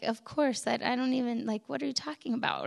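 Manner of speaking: soft, quick, dismissive puzzlement; puzzled aside. Retold or imitated. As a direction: toss it off under your breath, baffled. Her puzzled reaction to father-in-law.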